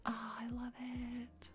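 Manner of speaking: slow, very soft swooning whisper; adoring whisper. Real, not acted. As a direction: breathe it out, smitten. Adoring a dress she found.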